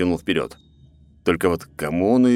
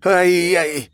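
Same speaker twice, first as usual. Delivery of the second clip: hissing in pain; 11 semitones higher, 4 dB louder, slower than usual. A narrator's reading. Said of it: "прошипел он".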